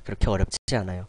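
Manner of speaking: ordinary talk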